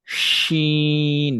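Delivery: শিন very slow, sh held long; drawn-out shin. Shown as a demonstration. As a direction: stretch শিন slowly. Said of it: "আর এটা হচ্ছে".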